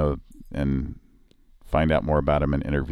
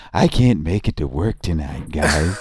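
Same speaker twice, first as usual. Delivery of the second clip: thirty-four guy voice; 3 semitones higher, 5 dB louder than usual. Retold or imitated. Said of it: "impersonate the thirty-four guy".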